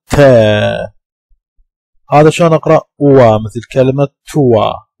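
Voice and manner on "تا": "تا" stretched out very slowly; very slow model word. Shown as a demonstration. Teacher modelling a sound for students, very slow. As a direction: draw the word out for learners.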